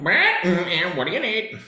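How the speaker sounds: a hint of mimicry; mimicking. Weird kid in the room mimicking people. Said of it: "mimicking people".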